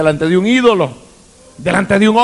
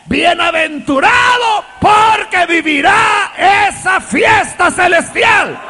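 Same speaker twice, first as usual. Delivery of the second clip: slow, high, shouted proclamation; preaching climax; 8 semitones higher, slower than usual. Real, not acted. Preacher rousing the congregation about the heavenly feast, shouted. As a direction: build it to a rousing peak.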